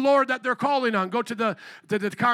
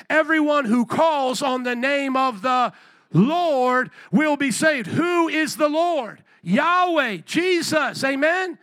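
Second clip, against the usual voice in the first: loud, raised-pitch sermon delivery; emphatic call-and-response preaching; full-force preaching; 4 semitones higher, 5 dB louder, slower than usual. Real, not acted. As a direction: drive the verse home to the congregation.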